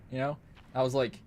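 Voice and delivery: normal talking voice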